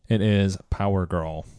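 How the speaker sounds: ordinary talk, nothing marked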